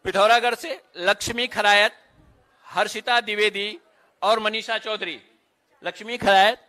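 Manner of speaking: measured announcer voice, each name set apart; roll-call announcer. Real, not acted. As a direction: call out names at a ceremony.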